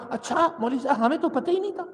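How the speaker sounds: high, soft mock-innocent excuse; wriggling out with a small, high voice; feigned ignorance. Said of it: "جیسے مولوی صاحب سے نکل جاتے ہیں".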